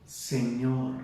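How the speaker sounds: a mild touch of trembling awe; trembling, fearful. Acted. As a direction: breathe out "Señor" like a frightened man on the ground. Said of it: "Temblando, temeroso".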